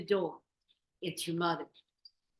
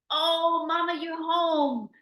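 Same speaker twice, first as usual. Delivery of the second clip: put-on joyful kids; 12 semitones higher, 10 dB louder, slower than usual.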